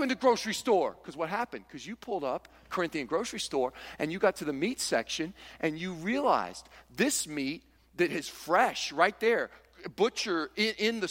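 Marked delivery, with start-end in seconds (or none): none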